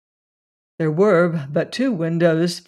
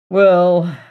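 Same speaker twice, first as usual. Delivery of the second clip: a loud, sudden call; cried out; 8 dB louder than usual. A narrator's reading. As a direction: burst in with it, much louder. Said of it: "cried Randolph".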